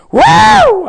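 very high shouted whoa; amazed whoa. Pretend. Preacher stunned by David's victory, shouting whoa. Astonished exclamation.

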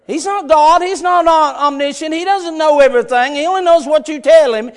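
fired-up preacher; full-force preaching. Real, not acted. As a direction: preach it hard, driving each point home.

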